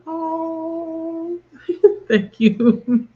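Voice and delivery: choked up with gratitude; moved to tears. Real, not acted. Moved by viewers' hugs.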